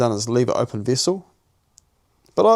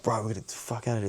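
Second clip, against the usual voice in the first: urgent hushed; 8 dB quieter, faster than usual. Retold or imitated. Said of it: "I'm like".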